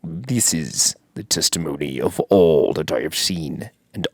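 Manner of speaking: low, dark movie-trailer narration; ominous reading. Acted. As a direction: read it like a horror book blurb. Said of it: "Let's read the back".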